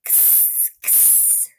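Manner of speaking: breathy unvoiced ks; x sound. Shown as a demonstration. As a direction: whisper the ks. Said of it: "And what sound?".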